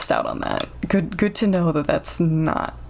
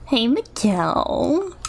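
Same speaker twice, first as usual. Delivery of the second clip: character voice; 4 semitones higher, much slower than usual. Acted. Voicing a game character.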